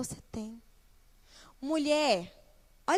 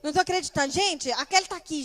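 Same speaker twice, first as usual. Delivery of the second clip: loud, higher-pitched playful disbelief; teasing mock surprise; 6 semitones higher, 8 dB louder, faster than usual. Pastor kidding Kelly for coming to church, mock amazed. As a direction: make a joking fuss over who turned up. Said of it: "eu ficava brincando com ela".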